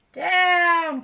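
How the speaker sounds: long, high, loud cry; urgent drawn-out storybook yell; witch crying out. A narrator's reading. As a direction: stretch the order into a shout. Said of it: "cried the witch".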